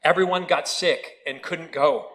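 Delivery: ordinary talk, nothing marked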